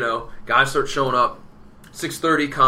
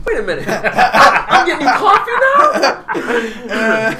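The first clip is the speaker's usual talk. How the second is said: high incredulous squeak breaking into laughter; mock-indignant, laughing at the insult; mad disbelief; 11 semitones higher, 10 dB louder, slower than usual. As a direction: laugh through the outrage, slowing on the last words. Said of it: "Terry Taylor now's mad".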